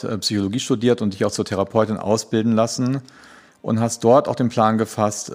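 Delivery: normal talking voice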